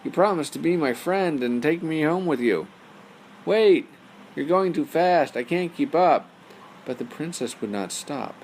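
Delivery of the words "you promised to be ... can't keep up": high, loud pleading call; calling after her. Acted. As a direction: shout after someone running away. Frog calls after fleeing princess.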